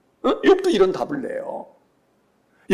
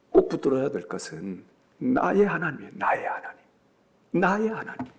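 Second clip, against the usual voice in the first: hushed intimate; 5 semitones lower, 8 dB quieter than usual. Acted.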